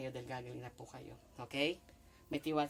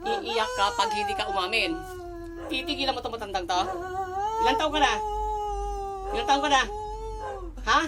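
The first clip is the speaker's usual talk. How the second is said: loud, raised threatening demands; forceful interrogation; 12 dB louder than usual. Real, not acted. Healer demanding the spirit confess, loud and forceful. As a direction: press hard at full volume.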